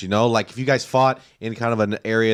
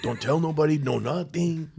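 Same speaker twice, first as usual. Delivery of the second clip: put-on Mexican accent; 3 semitones higher, 6 dB quieter than usual. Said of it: "I did a Mexican accent".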